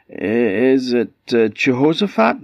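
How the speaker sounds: falter, then float the name upward; hesitant guessing. A narrator's reading. Queen makes another guess at the name, hesitant.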